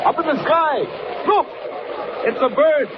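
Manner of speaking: high, ringing announcer cry; excited call-out. Acted. Announcer hails the hero to listeners, ringing call. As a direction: call it out to the whole sky. Radio serial opening call-out.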